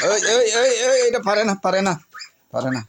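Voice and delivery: high, loud shout to stop the animals; shouting to break up a fight. Real, not acted. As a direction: yell sharply to split up the fighting animals.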